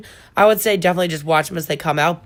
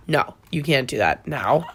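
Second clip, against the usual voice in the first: ordinary talk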